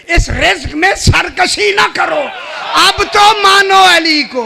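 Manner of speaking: loud, high preaching at full force; fervent shouted exhortation. Real, not acted. Preacher shouting to the congregation to accept Ali, fervently. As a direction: thunder it at the crowd.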